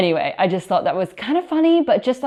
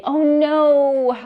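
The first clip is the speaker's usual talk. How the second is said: mock disappointed; 8 semitones higher, 6 dB louder than usual. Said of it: "unhappy face".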